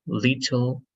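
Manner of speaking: "little" with a crisp T in the middle; clear T. Shown as a demonstration. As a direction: say "little" as LIT-tul, T fully released. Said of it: "T changes to a D sound".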